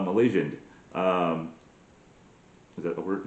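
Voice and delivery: ordinary delivery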